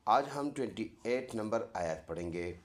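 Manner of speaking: ordinary delivery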